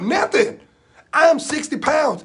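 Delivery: loud, high indignant outcry; indignant protest. Acted. Sixty-pound boy defends himself to teacher, indignant. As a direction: protest slowly and high, stretching the words.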